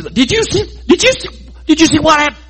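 high, breathless disbelief rising on see; amazed excitement. Retold or imitated. Israelites jostling after the giant falls, excited disbelief. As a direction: gush like stunned onlookers.

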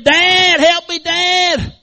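high, loud, drawn-out cry for help; desperate hollering. Acted. Boy hanging off the cliff to his dad, hollering. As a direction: holler at full force for rescue. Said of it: "he starts hollering".

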